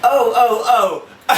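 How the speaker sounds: loud laughing disbelief; husband reliving his stunned reaction. Retold or imitated. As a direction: laugh out the shock. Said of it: "I was like".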